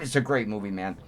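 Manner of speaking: ordinary delivery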